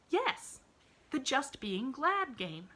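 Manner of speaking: put-on bright, high little girl voice; eager child reassuring her friend; cheerful little girl. A narrator's reading. Pollyanna voiced in story.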